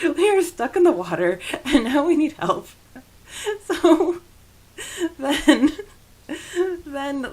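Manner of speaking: usual speaking voice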